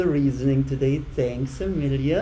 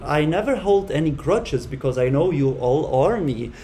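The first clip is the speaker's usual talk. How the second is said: ordinary delivery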